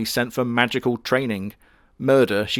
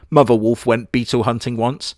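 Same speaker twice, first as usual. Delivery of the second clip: ordinary talk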